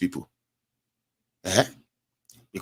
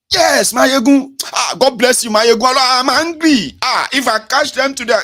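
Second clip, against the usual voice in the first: high, loud, slow furious caller; a fuming listener vowing revenge; worked-up caller shouting at the host; angry caller; 9 dB louder than usual. Retold or imitated. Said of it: "they just stand up ... and say".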